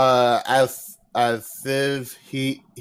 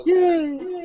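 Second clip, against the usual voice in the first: excited cheer. Real, not acted. Cheers about the monkey paw.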